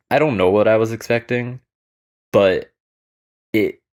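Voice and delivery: exasperated outburst. Real, not acted. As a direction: throw the line out, baffled. Repeats it, still stunned.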